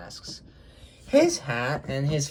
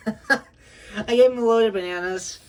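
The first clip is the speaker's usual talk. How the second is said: laughing; 9 semitones higher, 7 dB louder, slower than usual. Real, not acted. Laughing while describing his model.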